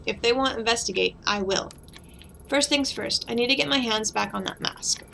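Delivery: ordinary delivery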